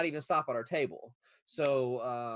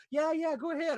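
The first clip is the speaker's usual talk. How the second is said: imitating the mom; 13 semitones higher, 5 dB louder than usual. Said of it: "I'm like".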